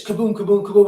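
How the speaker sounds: playful spoken kaboom, repeated; tossing off kabooms like little blasts; cartoon explosion noises said lightly; mock explosion sounds. Imitating exploding pagers.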